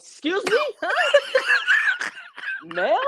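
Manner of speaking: squeaky high mock voice, incredulous; high, indignant mock of a wrestler's promo. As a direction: play her as shrill and offended. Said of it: "Jordan Grace's first promo".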